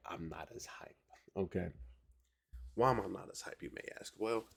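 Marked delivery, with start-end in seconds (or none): none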